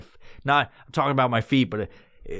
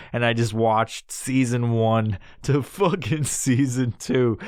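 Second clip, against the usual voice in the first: normal talking voice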